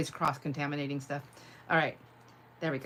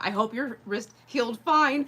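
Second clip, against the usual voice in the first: ordinary delivery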